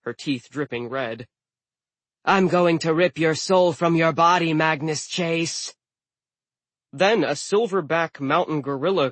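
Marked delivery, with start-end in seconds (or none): 2.2-5.8 raised, forceful monster snarl; snarling threat. A narrator's reading. Giantess threatening the boy she caught, snarling. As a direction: threaten loudly, slowing on the last words. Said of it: "she snarled".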